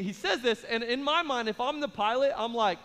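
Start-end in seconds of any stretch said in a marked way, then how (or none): none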